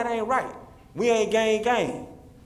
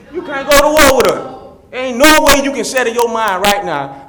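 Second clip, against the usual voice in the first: forceful, much louder public plea; loud calling out; 3 semitones higher, 14 dB louder than usual. Real, not acted. Speaker to officers at meeting, loud calling out. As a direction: drive it home loudly to the room.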